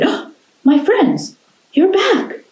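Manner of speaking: high, slow joyful greeting; delighted old man. Acted. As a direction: welcome them home gladly. Mister Lee greets his pets.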